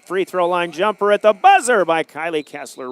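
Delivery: rising, loud buzzer call; excited play-by-play. Real, not acted. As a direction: call the last shot live. Live call of buzzer shot.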